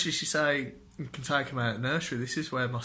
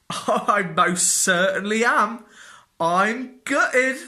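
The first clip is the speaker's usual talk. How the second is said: higher, louder, slow mock agreement; sarcastic agreement; 8 semitones higher, 8 dB louder, slower than usual. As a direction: overplay the misery to mock them. Sarcastic reply to colleagues.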